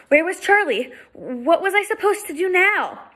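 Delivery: put-on high, panicky girl's voice; a scared kid looking for help; girl alone at the gate, panicking, high voice; frightened young girl.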